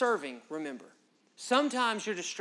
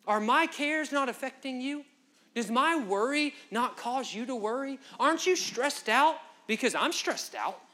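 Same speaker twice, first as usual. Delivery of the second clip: rising, pleading questions to Jesus; stressed pleading; 6 semitones higher, 5 dB louder than usual. Retold or imitated. Martha pleads with Jesus, rising and pressing. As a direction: press him to share her stress. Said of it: "Martha is saying".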